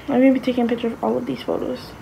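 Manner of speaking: ordinary talk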